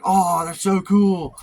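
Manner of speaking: high, drawn-out mock gushing; gushing listener. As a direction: imitate someone naively impressed, stretching the words. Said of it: "they're gonna be like".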